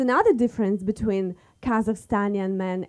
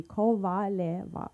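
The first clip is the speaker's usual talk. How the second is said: Kovaleva drawn out slowly, syllable by syllable; slow, careful name; 4 semitones lower, 6 dB quieter, slower than usual. Real, not acted. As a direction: say Kovaleva slowly and softer for the audience. Repeats surname after spelling it.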